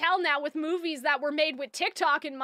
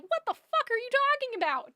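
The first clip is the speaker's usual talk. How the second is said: incredulous; 8 semitones higher than usual. Retold or imitated. Exasperated retort.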